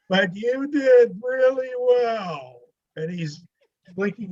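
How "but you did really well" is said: loud, high, very drawn-out imitation; broad mock hint. Interviewer slipping him the result, exaggerated knowing hint. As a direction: stretch it out as an unmissable wink.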